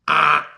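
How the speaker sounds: loud imitated buzzer blare; vocal buzzer noise; wrong-answer buzzer. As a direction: sound the game-show buzzer for a wrong answer. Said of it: "that was the buzzer".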